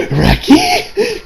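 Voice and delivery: very high comic squeak; wary questioning call. Acted. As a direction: squeal the name in mock dread. Acted suspicious call to author.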